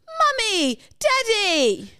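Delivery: high, drawn-out mock call to parents; mock airport greeting. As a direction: call out to mummy and daddy, acting it up. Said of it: "As if I'm arriving at the airport".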